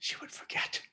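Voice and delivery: faint whisper; whispered vow. A narrator's reading. Narrator closes on the queen's vow, whispering. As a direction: let the vow fade to a whisper.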